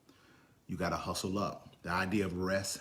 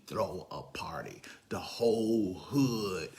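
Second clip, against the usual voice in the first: slow hushed emphasis; dramatic whisper; slower than usual. Acted. Preacher hushing the congregation for effect, whispered slowly. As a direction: drop to a whisper to savor it. Dramatic drop after build-up.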